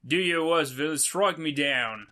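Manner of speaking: hammed-up Aussie defiance; badly faked Australian, slowing down; terrible Australian accent. Traveller defying the supposed villain, bad Australian accent. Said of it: "read this in a terrible accent".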